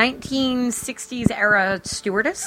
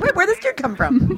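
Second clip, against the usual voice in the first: surprised; 10 semitones higher than usual. Retold or imitated. Mock inner voice reacting to movie.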